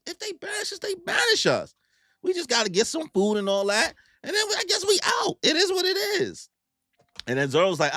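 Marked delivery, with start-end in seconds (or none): 0.1-6.5 squeaky Luffy. Retold or imitated. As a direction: shriek it like a carefree rubber kid. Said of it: "And Luffy's like".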